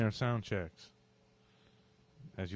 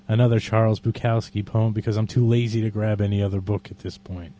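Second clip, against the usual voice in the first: ordinary talk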